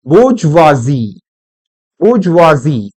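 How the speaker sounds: बूजवाजी as "boozh-waa-zee", deliberate and falling; correct pronunciation. Shown as a demonstration. Teacher gives students the correct sound, बूजवाजी as boozh-waa-zee. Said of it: "correct pronunciation है that is".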